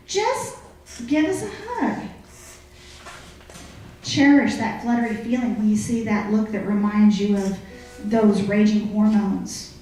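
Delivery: usual speaking voice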